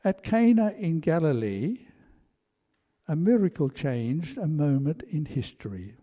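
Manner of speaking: ordinary delivery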